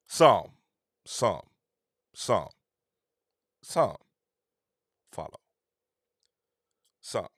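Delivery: each "Some" dropped alone after a long pause; slow emphatic pauses. Real, not acted. Man correcting his claim with drawn-out pauses on "Some". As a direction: let "Some" hang, then "follow".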